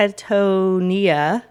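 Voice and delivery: Tetonia drawn out very slowly; sounding it out. Real, not acted. Host attempting an unfamiliar town name, slow sounding out. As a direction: feel your way slowly through Tetonia. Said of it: "There we go".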